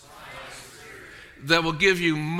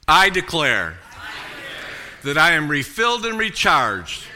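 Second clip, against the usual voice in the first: declaration; 2 semitones lower than usual. Acted. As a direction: proclaim it for all to repeat. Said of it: "say this declaration together".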